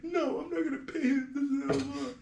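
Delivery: high, soft, drawn-out mimicry; mock bratty refusal. Client refusing his dad over paying, mocked as bratty. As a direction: imitate a brat refusing, slowly.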